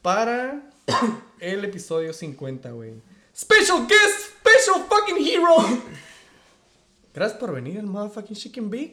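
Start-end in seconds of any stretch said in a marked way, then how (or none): 3.4-5.6 high, loud, slow guest intro; hype announcer. Acted. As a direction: bellow the guest's entrance.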